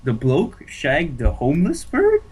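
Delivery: British phrase said as an unsure question; rising, uncertain try at the British line; hesitant rising read of the British phrase. Pretend. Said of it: "In a British accent, not a questionable tone".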